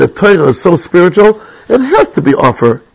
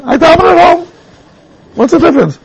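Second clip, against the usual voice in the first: higher, mock pupil voice; pupil's reply; 11 semitones higher than usual. Teacher mimicking his Hasmonean boys, higher mock voice. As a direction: imitate a shrugging boy.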